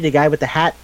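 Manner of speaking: usual speaking voice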